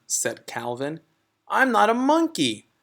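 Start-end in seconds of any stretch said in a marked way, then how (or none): 1.5-2.7 put-on higher, louder protesting kid; a boy objecting to being called a monkey; higher child voice, firm protest; child character voice. Calvin voiced in story.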